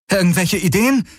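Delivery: high urgent call; asking a companion for help under fire. Acted. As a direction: call it over while running. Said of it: "rief Kirai".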